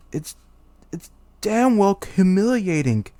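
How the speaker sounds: high, loud indignant king; outraged rant. Acted. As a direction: rant in wounded royal fury. Humiliated king ranting in outrage.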